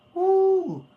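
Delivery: high exultant whoop; joyful whoop. Real, not acted. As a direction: let out a loud joyful woo. Exultant shout in worship.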